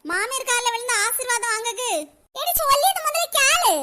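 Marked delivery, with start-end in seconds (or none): 2.4-3.7 higher-pitched, insistent command; mild scolding order. Acted. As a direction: scold her into obeying. Acted scolding of younger woman.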